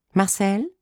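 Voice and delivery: high, tentative-sounding name call; woman caller. A narrator's reading. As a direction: ask for him with a rising lilt.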